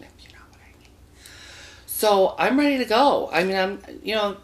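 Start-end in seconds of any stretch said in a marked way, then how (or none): 0.0-0.9 low, fast, breathless whisper; innuendo whisper. Acted. Telling the audience about his love life, in a sly whisper.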